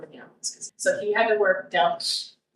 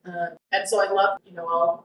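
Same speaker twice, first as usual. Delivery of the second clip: normal talking voice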